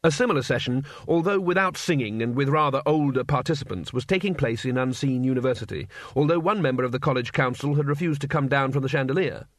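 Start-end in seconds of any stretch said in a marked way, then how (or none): none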